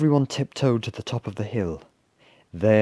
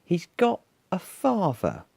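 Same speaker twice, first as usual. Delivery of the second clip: high, slow dawning realisation; drawn-out little-creature disbelief; slowly; 10 semitones higher than usual. A narrator's reading. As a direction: spell it out in a small stunned voice. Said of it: "said Basil slowly".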